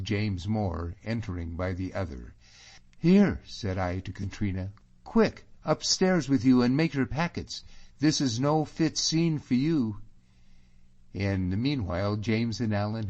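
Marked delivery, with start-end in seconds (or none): 3.0-3.4 loud, high, sudden call; urgent call. Acted. Narrator's character on meeting James, urgent call to Katrina. 5.0-10.0 higher, louder, pressing order; urgent command. Acted. Sending Katrina upstairs from the confrontation, urgent and raised.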